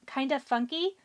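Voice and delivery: losing her voice, a bit hoarse; rough, worn-out voice, hoarse. Real, not acted. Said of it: "It sounds like I'm losing my voice".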